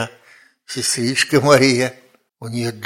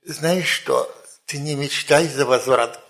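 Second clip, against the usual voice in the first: ordinary talk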